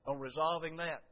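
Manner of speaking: usual speaking voice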